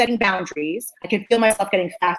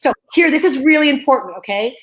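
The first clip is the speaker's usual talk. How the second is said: normal talking voice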